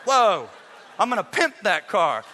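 over-the-top playful bragging; hammed-up excitement. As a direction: sell the car upgrade like a showman. Hammed-up promise to wife.

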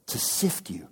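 hushed whispered warning. Acted. Preacher warning Peter in a whisper. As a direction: lean in and whisper it.

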